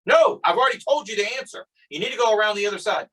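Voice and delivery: high-pitched imitation of an irritated official; retold exasperated refusal. Retold argument at road closure.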